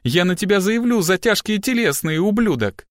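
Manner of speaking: high, pained ranting; outraged cry. A narrator's reading. Grabbed girl threatens her captor with charges, outraged. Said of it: "вскричала она".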